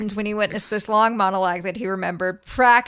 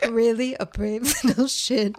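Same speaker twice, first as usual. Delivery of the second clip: imitating the line, breaking into a laugh; mock quote through laughter. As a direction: parrot the quote while cracking up. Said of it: "the way you said it".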